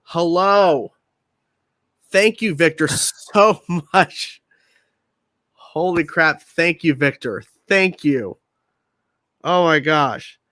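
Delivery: astonished thanks. Real, not acted. Host thanking Victor for a surprise gift, astonished. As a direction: exclaim it with a stunned laugh breaking in.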